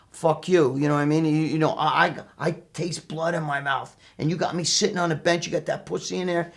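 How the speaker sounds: ordinary talk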